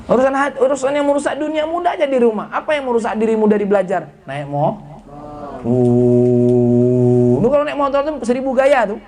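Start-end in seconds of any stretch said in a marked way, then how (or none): 5.7-7.3 long low "uuuh", held on one note; mock showing-off hoot; mimicked drawn-out hoot. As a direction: hold a low, steady "uuu". Mimics sound while mocking motorbike riding.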